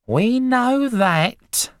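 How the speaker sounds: very high, drawn-out, loud retort; impatient wife, piercing and slow; ratty. A narrator's reading. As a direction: screech it with irritated scorn. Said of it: "ratty about missing her programme".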